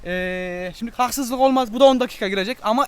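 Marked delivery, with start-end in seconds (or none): none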